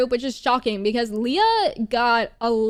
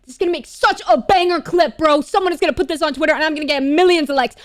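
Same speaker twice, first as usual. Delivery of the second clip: hammed-up bragging hype; mock hyped boasting; 3 semitones higher, 5 dB louder, faster than usual. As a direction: imitate someone giddy about going viral. Voices stars' scheming inner thoughts.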